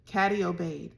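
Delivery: ordinary delivery, nothing marked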